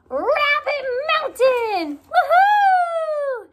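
high, loud triumphant whoop; childlike glee; gleeful cheer. Acted. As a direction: cheer like reaching the summit. Bunny on top of mountain.

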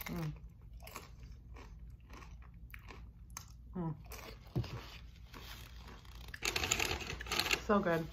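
talking while chewing; chewing chips. Real, not acted. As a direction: mouth partly full. Seen 2: Bites chip with guac at -5; lips closed, chewing through early words.

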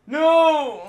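high, loud cry of dismay; anguished shout. Real, not acted. Gamer falls.

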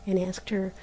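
ordinary delivery

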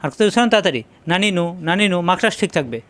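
normal talking voice

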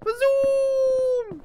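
very high, loud drawn-out zoom; shrieked zooming whoosh, held long; playful shout. Pretend. Streamer playing with a viewer's thanks, playful high shout.

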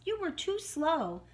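high, slow little mouse; lightly voiced mouse protest; piping baby mouse. A narrator's reading. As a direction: pipe up with drawn-out blame. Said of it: "piped up the baby mouse".

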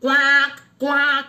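loud croaking quack; frog voice. Retold or imitated. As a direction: croak it like a toad. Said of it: "la voix de crapaud".

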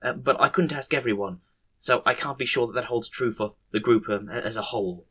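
soft, halting, stammered delivery; hesitant report. Acted. Nurse reporting back on the managers' votes, soft hesitant voice. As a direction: pick through it timidly with ums and restarts.

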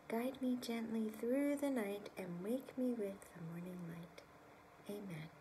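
soft fading whisper; whispered prayer. Acted. Reader whispering the bedtime prayer with the child. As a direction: pray gently into sleep.

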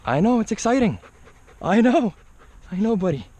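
high pet voice, calming an eager dog; high dog-talk voice. Real, not acted. Excited dog, owner soothing him.

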